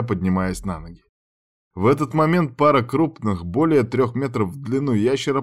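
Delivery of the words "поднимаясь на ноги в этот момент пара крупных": usual speaking voice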